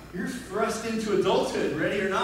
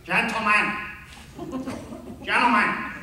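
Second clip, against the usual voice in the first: louder, commanding gym-teacher call; distinctive coach voice; 3 semitones higher, 3 dB louder than usual. Retold or imitated. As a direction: call the class to attention, loudly. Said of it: "in that very distinctive voice".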